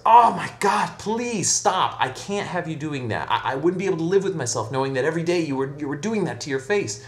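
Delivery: high, distressed appeal easing slightly; horrified pleading. Acted. Priest begging the hunchback to stop hurting his face, horrified.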